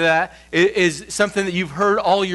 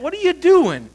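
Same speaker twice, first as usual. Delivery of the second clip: scolding voice; 10 semitones higher, 3 dB louder than usual. Acted. Scolding voice of less.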